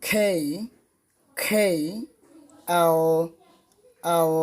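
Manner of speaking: "K" as a gliding kay, "L" with a dark, nearly vowel-like ending; correct English pronunciation. Shown as a demonstration. Teacher leading repeat-after-me, careful English "K" and "L". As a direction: model "K" and "L" slowly, each twice. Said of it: "আমরা সঠিকভাবে ইংরেজি বর্ণমালা".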